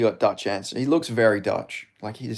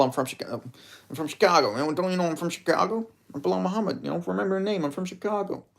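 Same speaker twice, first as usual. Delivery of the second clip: higher-pitched mimicking voice; Bilal; 6 semitones higher, 4 dB quieter, faster than usual. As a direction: put on a high voice, lightly mocking. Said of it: "Do an impression of Bilal".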